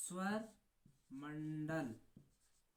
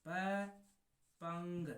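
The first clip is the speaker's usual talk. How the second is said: बपंग stretched very slowly; drawn-out name; much slower than usual. Shown as a demonstration. Teacher modelling बपंग for students, very slowly stretched. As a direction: linger on बपंग syllable by syllable. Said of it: "B से बन जाएगा बपंग".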